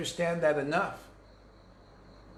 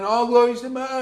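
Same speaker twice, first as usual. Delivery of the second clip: choked-up devotion; 6 semitones higher, 6 dB louder than usual. Retold or imitated. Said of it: "you can't even get the words out".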